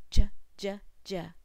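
j sound clipped three times; isolated j sound. Shown as a demonstration. Phonics teacher isolates the j sound. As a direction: tap out the j sound. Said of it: "Jump rope".

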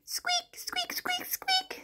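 tiny, very high soft squeaks; mouse squeak. Pretend. Teacher playing a mouse for children, tiny high squeaks. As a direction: squeak softly at the very top of your voice. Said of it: "says the mouse".

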